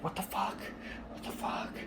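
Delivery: hushed, mimicked disbelief; imitated whisper. Woman beside me during the movie, mimicked whispering. Noisy neighbours muttering in the cinema.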